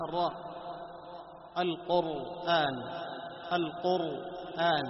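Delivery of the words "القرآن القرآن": القرآن with a heavy, full-mouthed راء, slowly; drawn-out Quran reading. Shown as a demonstration. Teacher demonstrating a heavy راء in القرآن. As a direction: stretch القرآن out and thicken the راء. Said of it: "لاحظ".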